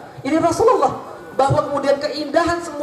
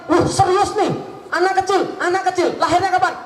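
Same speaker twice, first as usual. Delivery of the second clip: panicked Pharaoh; 7 semitones higher than usual. Pretend. Pharaoh panicking at the prophecy, high and urgent. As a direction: voice jumps up in alarm. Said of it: "Langsung panik deh".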